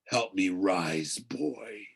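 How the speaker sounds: slow, low craving voice; slow hungry voice from the pit; hungry ancient voice. A narrator's reading. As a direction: pull him in slowly, hungrily. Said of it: "The voice became hungrier".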